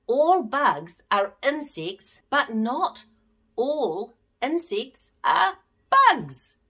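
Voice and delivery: every word slow and clear; slow, deliberate teacher's fact; said slowly. Shown as a demonstration. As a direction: spell out the fact slowly for children. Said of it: "which I say really slowly".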